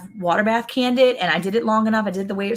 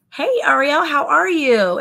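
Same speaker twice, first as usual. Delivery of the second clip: bright greeting; 8 semitones higher, 4 dB louder, slower than usual. Real, not acted. Greeting live chat viewer.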